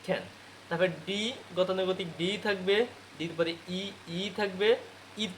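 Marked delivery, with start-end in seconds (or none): 0.1-0.2 খ্যান with a puff of air on the K; short, aspirated খ্যান; aspirated pronunciation. Shown as a demonstration. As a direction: breathe out on the first sound of খ্যান, "khen". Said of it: "ক্যান কী হবে".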